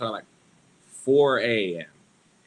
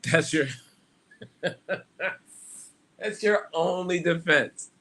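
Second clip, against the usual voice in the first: normal talking voice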